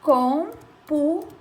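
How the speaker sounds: "computador" said slowly, split as com-pu-ta-dor; syllables split. Shown as a demonstration. Teacher giving a polysyllable example, slow and split. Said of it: "Outra palavra polissílaba".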